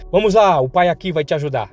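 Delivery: a father rallying his son; cheerful father. Acted. (pai animado, voz mais alta)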